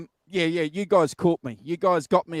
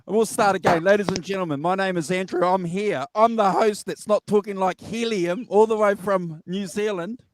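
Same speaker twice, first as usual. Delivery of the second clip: ordinary talk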